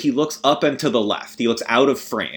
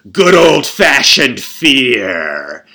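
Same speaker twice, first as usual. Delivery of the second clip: very slow, louder menacing growl of a line; Lance Henriksen; 8 dB louder, much slower than usual. Retold or imitated. Villain revealing the kids died of fear, in a slow, loud menacing voice. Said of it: "in his Lance Henriksen voice".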